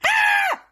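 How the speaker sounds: very high, loud shriek; re-enacted scream of sudden fright; startled scream. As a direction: scream out in shock. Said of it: "So I'm like".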